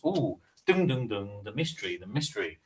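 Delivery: playful, hushed mock suspense; hammed-up quiz-show ding; mock mystery. Teasing the sealed-orders mystery.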